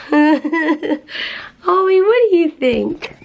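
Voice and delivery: high, drawn-out pet voice; talking to a dog. Real, not acted. Owner asking the dog Ollie's opinion, high pet voice. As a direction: coax the dog in a high singsong.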